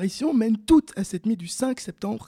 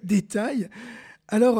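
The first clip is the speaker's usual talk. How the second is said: usual speaking voice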